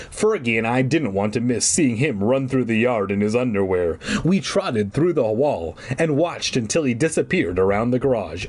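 plain reading voice

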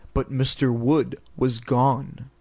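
plain reading voice

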